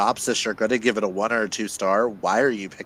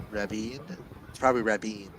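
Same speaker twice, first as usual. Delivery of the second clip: ordinary talk, nothing marked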